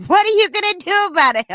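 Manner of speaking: hammed-up furious resident voice; mock angry lady. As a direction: yell it like the fed-up homeowner. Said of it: "then she says".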